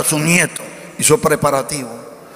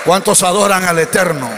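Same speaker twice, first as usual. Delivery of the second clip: preacher's rallying call, building. Real, not acted. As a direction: rouse the congregation step by step. Rallies congregation to worship, building.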